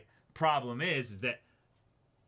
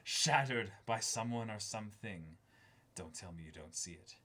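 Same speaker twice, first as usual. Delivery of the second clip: lower, much softer, almost whispered epic lines; hushed trailer read; 4 semitones lower, 6 dB quieter than usual. Acted. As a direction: breathe the lines like a dark trailer. Reads box text dramatically.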